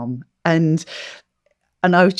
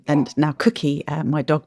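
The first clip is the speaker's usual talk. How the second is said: usual speaking voice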